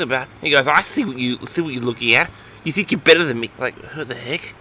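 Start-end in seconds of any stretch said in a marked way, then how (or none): none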